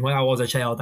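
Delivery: normal talking voice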